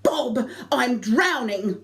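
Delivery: high, slow, loud cry for help; panicked scream. Retold or imitated. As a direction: scream his name as you go under. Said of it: "So I screamed out".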